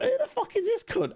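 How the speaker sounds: high squeak broken by laughter; scoffing at the casting, high and laughing; laughing disbelief. Retold or imitated. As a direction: cackle it out in mock outrage. Mocking a badly cast actress.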